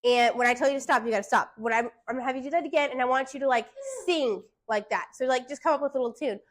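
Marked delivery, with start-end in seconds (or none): none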